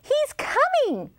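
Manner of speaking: high-pitched, alarmed mouse squeak; storybook mouse in a high squeak. A narrator's reading. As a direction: squeal a warning like a tiny mouse. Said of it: "squeaked a mouse".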